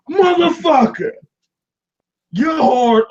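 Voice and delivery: high, slow, stretched shout of frustration; exasperated yell. Retold or imitated. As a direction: yell it at your own body, fed up. Yelling at his own body.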